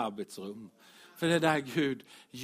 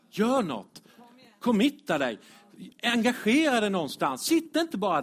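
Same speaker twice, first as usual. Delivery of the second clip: emphatic call; 7 semitones higher, 6 dB louder than usual. Real, not acted. Preacher repeats exhortation, raised.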